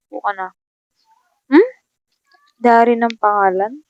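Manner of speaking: ordinary delivery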